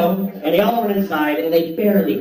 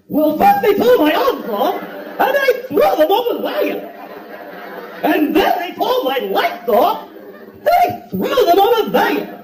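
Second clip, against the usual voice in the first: high, slow, hammed-up wail; even bigger Scarecrow take; 11 semitones higher, slower than usual. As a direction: overact it further, pitch soaring. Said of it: "let him do another one".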